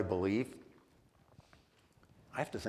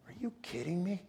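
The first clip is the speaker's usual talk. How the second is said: disbelief; 10 semitones higher, 6 dB quieter than usual. Retold or imitated. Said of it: "I was like".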